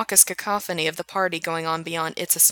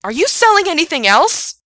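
outraged demand; 11 semitones higher, 10 dB louder than usual. Acted. Gerard accusing Alex.

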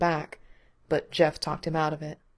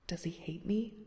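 a thin, voiceless whisper, much softer; frightened whisper; 12 dB quieter than usual. A narrator's reading. Gerard whispering his fear, too scared to say it aloud. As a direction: breathe the question out, scared to ask.

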